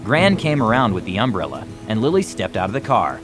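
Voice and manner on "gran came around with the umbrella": usual speaking voice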